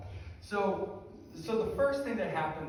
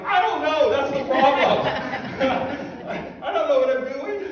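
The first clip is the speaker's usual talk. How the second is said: much higher and louder, laughing through it; laughing helplessly; 10 semitones higher, 12 dB louder than usual. Retold or imitated. Confessing to the scholar, laughing. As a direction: give up and laugh at yourself.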